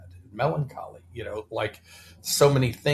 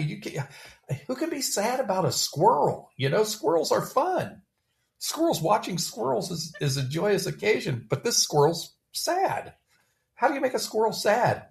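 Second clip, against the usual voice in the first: much higher, playful incredulous voice; amused disbelief; 10 semitones higher than usual. Real, not acted. Marveling to a friend about the poem, high and playful. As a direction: squeal about sad squirrels.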